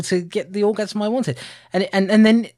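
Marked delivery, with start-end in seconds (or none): none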